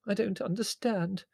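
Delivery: high, plaintive complaint. A narrator's reading. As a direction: protest helplessly, at a loss. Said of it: "said Mother plaintively".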